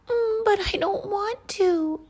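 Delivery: very high, loud, squeaky plea; pleading snowflake. A narrator's reading. Snowflake begging the cloud not to fall, high pleading. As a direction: plead like a tiny frightened character. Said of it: "pleaded the snowflake".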